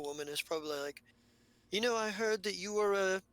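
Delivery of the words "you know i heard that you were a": louder, higher, keen to hear the story; eager probing. Acted. Lashunta woman presses the captain, louder and eager. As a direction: lean in with interest.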